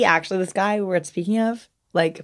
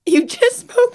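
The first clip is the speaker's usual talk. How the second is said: high-pitched mock disbelief; retold bartender's astonished query; imitating incredulous bartender; 13 semitones higher, slower than usual. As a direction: catch them out with a squeaky, startled question. Imitating bartender catching her out.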